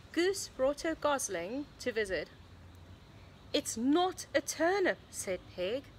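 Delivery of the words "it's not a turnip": higher-pitched, mildly upset protest; upset insistence. A narrator's reading. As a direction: insist like a storybook pig getting upset. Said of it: "Poor Pig's getting so upset".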